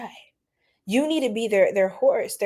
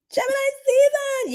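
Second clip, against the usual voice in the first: high sing-song. Acted. Announces the season, very high.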